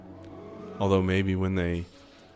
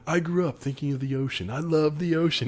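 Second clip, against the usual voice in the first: higher, dreamy mock Paris voice; 7 semitones higher than usual. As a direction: gush like a sappy crewman. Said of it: "Paris is like".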